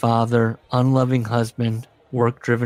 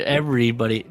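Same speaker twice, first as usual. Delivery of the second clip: ordinary talk, nothing marked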